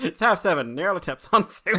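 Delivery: giggling through the words; laughing. Retold or imitated. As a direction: laugh while repeating it. Mocking dad's line, laughing.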